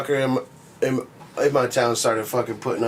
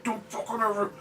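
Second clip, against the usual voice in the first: high, hushed mock scream; retold dad's scream; 10 semitones higher, 8 dB quieter than usual. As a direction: scold like an angry dad without the volume. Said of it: "screaming at me".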